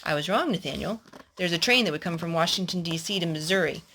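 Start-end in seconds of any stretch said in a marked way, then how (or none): none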